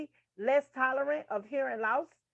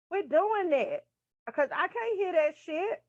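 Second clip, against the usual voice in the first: exasperated; 6 semitones higher than usual. Real, not acted. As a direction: scold them, clearly fed up. Scolding classmates, annoyed.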